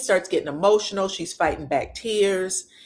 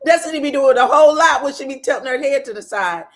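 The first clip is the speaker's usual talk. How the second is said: loud, fast, higher, just after a laugh; amused outburst; 7 semitones higher, 8 dB louder, faster than usual. Real, not acted. Vlogger cracking up over Destiny tilting her head, amused outburst. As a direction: rattle it off loudly through amusement.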